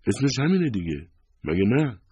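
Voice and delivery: high, defensive retort; wife on the defensive, voice lifted. A narrator's reading. Wife defensive when questioned about name.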